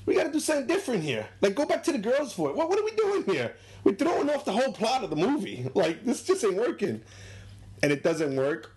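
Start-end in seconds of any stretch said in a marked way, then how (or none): 0.1-7.0 put-on much higher, exasperated old spectator voice; a frustrated fan complaining the plot isn't working; high whiny moviegoer griping at the actors; fed-up old man. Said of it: "goes to the people in the movie and is like".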